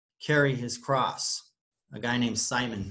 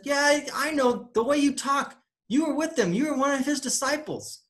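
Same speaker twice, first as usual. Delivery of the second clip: much higher put-on voice of a suspicious onlooker; accusing bystander; 12 semitones higher than usual. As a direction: point the finger in a high, prying voice. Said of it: "one of them said".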